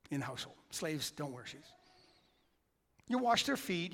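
ordinary talk, nothing marked

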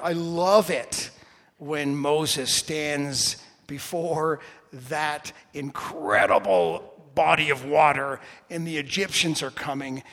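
normal talking voice

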